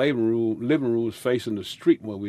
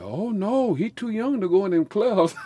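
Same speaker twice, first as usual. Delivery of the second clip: higher, fussing mother's voice; imitating protesting mother; 10 semitones higher than usual. Mother refuses to let her young son play clubs, higher and fussing. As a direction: object like a worried mother. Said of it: "And my mother said".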